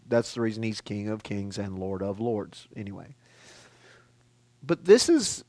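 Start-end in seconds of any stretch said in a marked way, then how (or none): none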